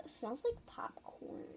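soft whispered aside; hushed delight. Real, not acted. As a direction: whisper it close to the mic. Sniffing the bun.

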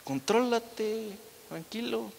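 soothing but menacing, drawn out; imitated soft slow warning; mock calm-down. Brother telling him to calm down.

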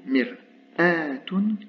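mir'atun stretched very slowly; slow demo. Shown as a demonstration. Teacher slowing the word so students catch the hamza, very slowly. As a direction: lay out mir'atun syllable by syllable. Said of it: "en position médiane".

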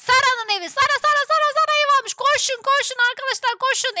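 high, excited calling to the team; rally everyone toward a new target. Real, not acted. Spots a house to rob. (heyecanla ekibi çağırma)

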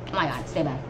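normal talking voice

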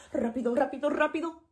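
rapid-fire rápido; fast. Shown as a demonstration. Teacher showing rápido by rattling it off fast. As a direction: race through the repeats. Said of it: "fast and slow".

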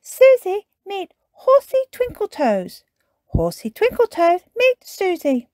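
put-on high child character voice making introductions; Peppa, excited introduction. A narrator's reading. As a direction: introduce them brightly, pitched high and loud. Peppa proudly introduces toy horse.

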